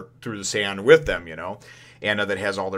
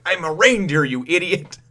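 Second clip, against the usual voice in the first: a hint of an irritated snap; mock annoyed retort; 8 semitones higher, 5 dB louder than usual. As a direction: talk back like he's fed up. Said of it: "he's like".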